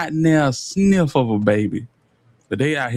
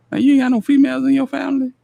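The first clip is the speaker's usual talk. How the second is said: high, fast, disbelieving; incredulous outburst; 12 semitones higher, much faster than usual. Real, not acted. Reacting to her story, high fast disbelief. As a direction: fire it off in total disbelief.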